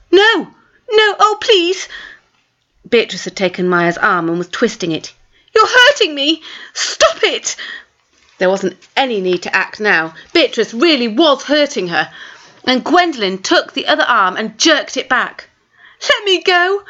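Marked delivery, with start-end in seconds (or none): 0.1-1.9 high, frightened pleading. Acted. Maya pleading, arm twisted. 5.5-7.6 high, tearful cry of pain. Acted. Arm twisted, in pain. 16.0-16.8 pleading. Acted. Arm jerked back.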